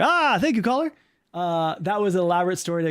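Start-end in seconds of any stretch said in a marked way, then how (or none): 0.0-0.9 high, hammed-up host thanking a caller; call-in show host. Streamer as host wraps up a call, hammed-up announcer. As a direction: play the host, pitched well up. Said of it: "And you're like".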